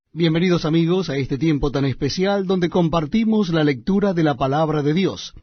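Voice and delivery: ordinary delivery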